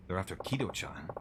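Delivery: whispered in alarm; hushed whisper. Acted. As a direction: breathe it out in a worried whisper. Shocked aside about kidnapped friend.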